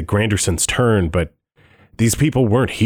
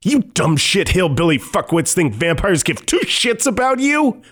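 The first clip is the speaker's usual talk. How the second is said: high spitting rage, climbing at the end; enraged shout; 10 semitones higher than usual. Acted. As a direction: lose it completely, screaming. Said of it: "losing his mind as flecks of spittle fly".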